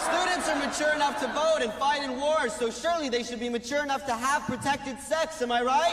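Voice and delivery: loud, high rally speech; rousing shout. Acted. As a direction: whip up the crowd. Campaign speech climax to students.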